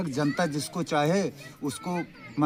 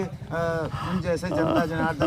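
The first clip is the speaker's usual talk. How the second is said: ordinary delivery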